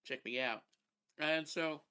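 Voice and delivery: talking with a mouthful; words around a bite of food; chewing something. Real, not acted. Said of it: "Sorry, chewing something".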